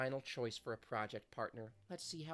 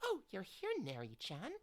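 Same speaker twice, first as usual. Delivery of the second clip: put-on sweet idol girl; 9 semitones higher than usual. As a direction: gush kindly like a gentle pop idol. Voices sweet idol character.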